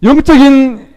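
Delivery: high, loud incredulous protest; indignant rebuke. Acted. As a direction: challenge him with outraged rising questions. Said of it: "꾸짖고 있습니다".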